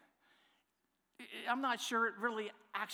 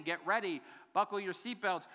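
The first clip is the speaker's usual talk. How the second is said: normal talking voice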